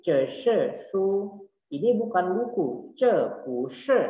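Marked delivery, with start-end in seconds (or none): 0.1-1.3 "ce shi shu" said slowly, syllable by syllable; slow teacher demo; slow model reading. Shown as a demonstration. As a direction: spell out each Mandarin syllable for the class. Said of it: "Ini adalah buku".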